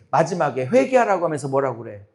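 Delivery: normal talking voice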